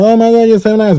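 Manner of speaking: high, gushing imitation of the girl; high mock gushing girl. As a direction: squeal it like she's gushing over him. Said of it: "she was like".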